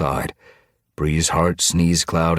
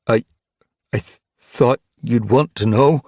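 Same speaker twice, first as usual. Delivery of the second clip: high, halting stammer; unnerved, raised, faltering voice; confidence gone; 8 semitones higher, 6 dB louder than usual. A narrator's reading. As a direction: stumble over it nervously. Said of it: "Gorseclaw's confidence had evaporated".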